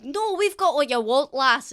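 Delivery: gruff orc barkeep arguing; loud orc insistence. Acted. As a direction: overrule her firmly. Orc argues loudly with barmaid.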